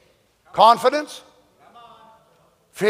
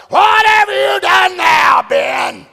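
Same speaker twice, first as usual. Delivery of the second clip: angry God scolding; 4 dB louder, slower than usual. Retold or imitated. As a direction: thunder at the sinner like a wrathful judge. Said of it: "That's the way we picture God".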